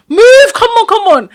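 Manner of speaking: high, loud "move" imitating a hurrying Caribbean auntie; retold urgent order. Auntie hurrying her out of the store, mock urgent shout. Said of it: "she had, like, an accent, she's Caribbean".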